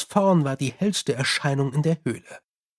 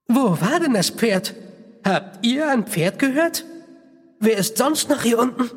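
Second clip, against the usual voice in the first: alarmed; 7 semitones higher than usual. Acted. Frightened dwarves question.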